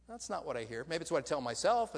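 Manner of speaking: normal talking voice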